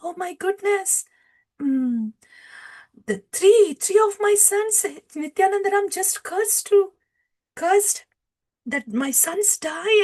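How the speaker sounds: high-pitched imitated panic; panicked crying wife. Wife hears her sons have been cursed, panicked. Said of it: "She gets panicked and she starts crying".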